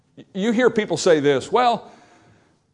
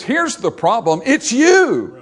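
emphatic accusation; 6 semitones higher, 3 dB louder, slower than usual. Acted. God's verdict voiced by preacher.

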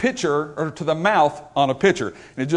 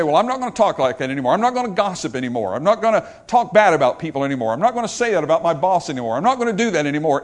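quick, singsong run of promises; rattled-off resolutions; faster than usual. Acted. Preacher mimicking people vowing to change their talk, rattled off. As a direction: reel off each vow briskly in a repeating pattern. Listing empty resolutions in a quote.

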